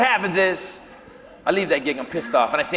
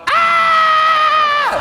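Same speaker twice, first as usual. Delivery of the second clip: shrill high-pitched loud shriek of fright; high scream; 7 dB louder than usual. Retold or imitated. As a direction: scream like you just saw a giant bug. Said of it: "here's what a tough badass I am. I go".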